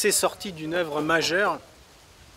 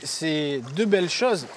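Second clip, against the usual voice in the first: normal talking voice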